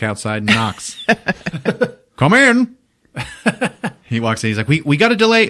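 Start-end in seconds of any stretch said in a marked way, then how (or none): 2.2-2.8 high, loud mock call. Boss answering a knock he ordered, hammed-up loud call. Acted boss calling through door. 4.7-5.5 high, loud, stammered urgency; urgent demand. Retold or imitated. Agent walking in after knocking, high loud mock urgency.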